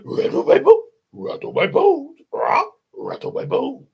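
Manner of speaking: spooky squawk, high then dropping; spooky parrot voice. Acted. Parrot gives imitation of a spooky voice, eerie squawk. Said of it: "wonderful imitation of a spooky voice".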